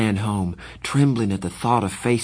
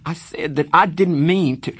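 higher, louder frustrated protest; frustrated tears; 8 semitones higher, 5 dB louder than usual. Acted. Pony repeating himself to Darry, frustrated. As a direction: insist through rising tears.

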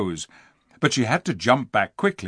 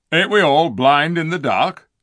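gruff dwarf; 5 semitones higher, 6 dB louder than usual. Acted. Diggle the dwarf speaking.

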